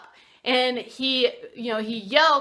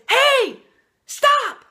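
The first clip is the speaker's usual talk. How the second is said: sharp, very loud high shout to stop a fight; loud yell; 9 dB louder than usual. Retold or imitated. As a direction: bellow it across the subway car to freeze everyone. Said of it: "the little man yelled at the big man".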